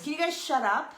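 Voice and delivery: high, loud retold yell. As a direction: snap it loudly, imitating a fed-up teen. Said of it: "and then Erica yells".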